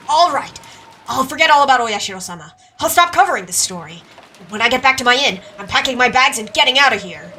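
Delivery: agitated declaration of quitting; rattled Yoshimura. Acted. Yoshimura swearing to drop the story, agitated. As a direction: blurt it out, fed up and anxious.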